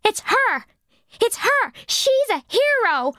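accusing shout. Acted. The girl pointing her out to the camera, high mock shout. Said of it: "shouts, almost in accusation".

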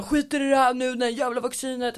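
high, loud retold tantrum; worked-up cursing, re-enacted at high pitch; hysterical, angry. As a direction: act out a hysterical rant to mum. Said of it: "jag är hysterisk".

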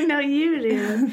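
high, slow giggly coo; giggly late night call. Pretend. Lovers refusing to hang up.

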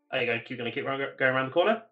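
ordinary talk

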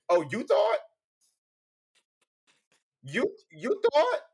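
loud, high taunting question; mock challenge. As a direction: throw it out as a drawn-out taunt. Acted-out challenge in wrestling promo.

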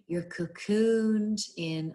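stretched-out mock affirmation; affirmation recording. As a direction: imitate a soothing hypnobirthing guide. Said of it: "with all the affirmations".

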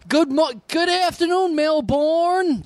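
high, drawn-out American announcer greeting; hammed-up American hailing Melbourne with a long "born"; mock American accent. Australian making fun of visiting Americans, hammed-up American accent. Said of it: "check out the American coming".

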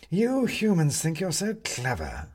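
high, slow cat voice; a mocking cat, slow; scornful cat. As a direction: sneer at the clever humans. Cat mocks humans.

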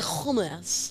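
"hummus" with a scraped throaty KH start. Retold or imitated. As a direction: open "hummus" with a rasped KH. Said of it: "I definitely don't do the".

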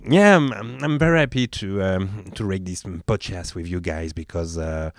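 low, easygoing American-style English; De Niro-like English. Shown as a demonstration. Host showing co-hosts his English, low American-style accent. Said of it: "c'est du côté de Niro un peu".

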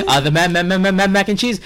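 flustered on camera, can't get the word out; stammering panic. Retold or imitated. Chef panicking in a live interview, stuttering out mac and cheese. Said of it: "I'm like".

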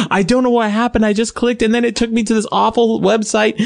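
high mock-distressed mother voice; imitating a flustered, upset mom; frantic mom. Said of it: "frantic. She's crying".

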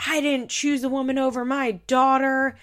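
mock shouting back at his family; defensive father yelling back; retold yell. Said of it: "Butch yells back".

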